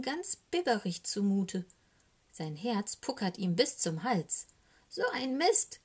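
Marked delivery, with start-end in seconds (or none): 4.9-5.8 high, whiny complaint; fed-up little raven moaning; lamenting. A narrator's reading. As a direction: wail it in self-pity. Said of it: "klagt er".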